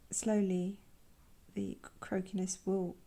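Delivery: normal talking voice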